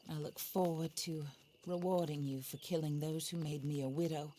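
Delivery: usual speaking voice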